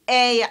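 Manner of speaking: "A" loud, as "ay-uh"; triphthong pronunciation. Shown as a demonstration. Teacher showing what's called triphthongs, "A" as "ay-uh". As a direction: add a short schwa after "A". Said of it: "we pronounce it as".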